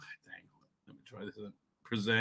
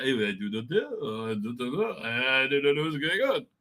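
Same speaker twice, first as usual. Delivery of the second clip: imitated French accent; 3 semitones lower, 4 dB quieter than usual. Family catching daughter-in-law's accent, imitated French accent. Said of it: "everybody starts talking in that accent".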